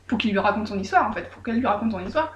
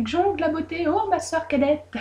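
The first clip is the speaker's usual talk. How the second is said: sœur mielleuse; 6 semitones higher than usual. A narrator's reading. Oulek flatte sa sœur, mielleuse.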